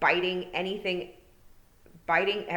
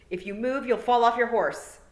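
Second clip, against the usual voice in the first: commanding warning; 7 semitones higher, 7 dB louder than usual. A narrator's reading. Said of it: "trying to sound commanding".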